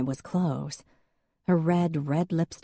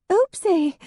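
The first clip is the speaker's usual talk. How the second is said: very high, louder little oops; sheepish oopsie; 7 dB louder than usual. A narrator's reading. Drunk Hadley after breaking a window, high sheepish oops. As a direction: caught out, childish and startled. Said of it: "she said".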